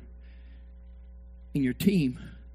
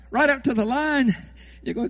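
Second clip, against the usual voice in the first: ordinary talk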